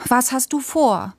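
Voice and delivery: put-on high-pitched, pressing child's question; insistent questioning. A narrator's reading. Child asks Papa what he is planning, insistent.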